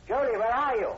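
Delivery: loud, high call into the dark. Acted. Professor Taylor searching the house for Julie, calling loudly. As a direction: search for her by shouting.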